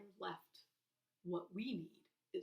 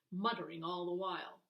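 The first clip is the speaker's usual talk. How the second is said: usual speaking voice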